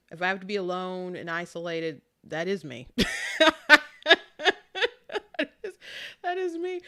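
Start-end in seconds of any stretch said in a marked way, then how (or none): 2.9-6.8 high-pitched, through laughter; laughing. Real, not acted. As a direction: laugh while owning up to it. Laughing at own admission.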